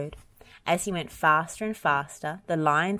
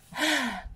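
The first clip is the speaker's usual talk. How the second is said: tired sigh; 9 semitones higher than usual. A narrator's reading. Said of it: "panted Rolly".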